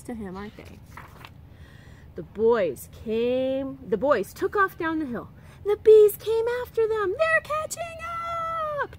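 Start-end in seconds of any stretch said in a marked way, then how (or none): 5.6-8.9 much higher and louder, panicky; yelp. Acted. Tom fleeing the swarm, high panicky yelp. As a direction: yelp it while running from bees. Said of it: "Tom yelped".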